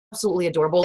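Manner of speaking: ordinary delivery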